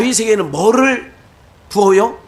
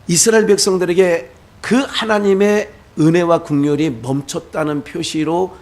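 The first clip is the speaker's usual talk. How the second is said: ordinary delivery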